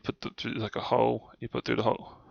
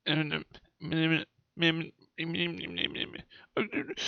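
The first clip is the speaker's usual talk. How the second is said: anxious whimpering; 5 semitones higher, 6 dB quieter, slower than usual. Retold or imitated. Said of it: "And I'm like".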